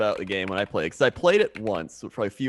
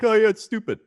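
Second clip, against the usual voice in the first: high, louder mock brush-off; hammed-up sneer at MTV; dismissive scoff; 10 semitones higher, 5 dB louder than usual. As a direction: toss it away like it's beneath you. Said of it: "You think M T V, like".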